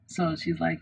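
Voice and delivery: ordinary delivery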